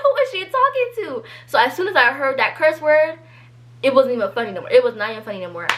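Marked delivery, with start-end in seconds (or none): 0.0-1.2 very high mock disbelief; incredulous. Narrator asking the room about the friend, high exaggerated disbelief. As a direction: ask the room in exaggerated shock.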